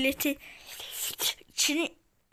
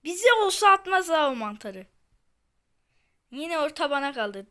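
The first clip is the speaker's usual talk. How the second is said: ordinary delivery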